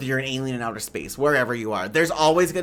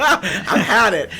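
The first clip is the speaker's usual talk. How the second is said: louder, slow, laughed-through words; laughing; 5 semitones higher, 6 dB louder, much slower than usual. Real, not acted. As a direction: laugh it out, done with them. Laughing at own threat.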